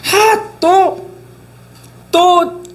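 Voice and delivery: high-pitched exasperated grumble, hammed up; mock cursing outburst. Preacher acting out people who swear when wronged again, mock cursing. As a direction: burst out high, as if fed up. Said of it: "이렇게 하면서 막 욕을 해요".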